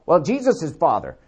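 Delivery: ordinary talk, nothing marked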